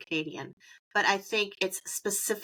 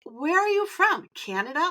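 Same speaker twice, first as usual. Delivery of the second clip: teasing family; 8 semitones higher than usual. Retold or imitated. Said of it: "They're like".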